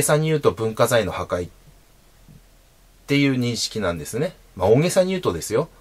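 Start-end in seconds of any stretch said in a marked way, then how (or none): none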